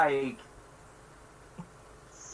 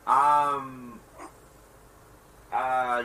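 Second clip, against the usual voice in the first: ordinary delivery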